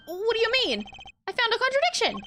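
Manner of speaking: high-pitched indignant lawyer; indignant protest. Acted. Lawyer insisting to the judge that a contradiction was found, indignant protest.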